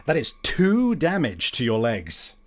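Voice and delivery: ordinary talk, nothing marked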